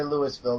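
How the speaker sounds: "Louisville" said as LOO-is-vil; wrong-sounding pronunciation. Shown as a demonstration. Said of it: "That just sounds wrong".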